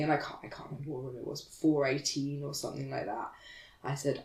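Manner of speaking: ordinary delivery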